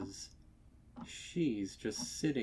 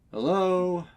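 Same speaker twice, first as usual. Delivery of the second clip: raised voice calling into the house; 7 semitones higher, 10 dB louder than usual. A narrator's reading. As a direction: call to find anyone home. Stallion calling from front room.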